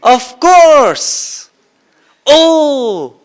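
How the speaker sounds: high, loud cry of awakening; ecstatic cry. Retold or imitated. Janaka hears Ashtavakra's verses and cries out in ecstasy. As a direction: burst out in sudden joy. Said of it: "he jumps from his seat".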